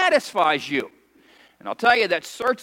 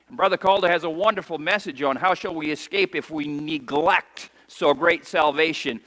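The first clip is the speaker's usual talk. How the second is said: usual speaking voice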